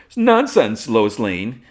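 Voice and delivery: loud, high mock-dismissive outburst; brash interruption. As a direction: burst in loudly as a showy reporter. Cat Grant pops up, interrupting.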